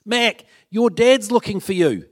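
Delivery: put-on kids calling out. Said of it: "all the kids were yelling out".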